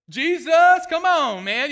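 loud, high burst of praise; full-voiced preacher's cry; preaching shout. Real, not acted. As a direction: shout the name out with fire. Preacher erupts naming Jesus.

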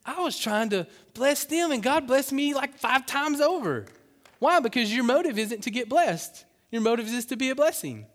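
high, loud preaching; impassioned preaching. Acted. Preacher stirring up the congregation, impassioned. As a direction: drive each phrase home at full voice.

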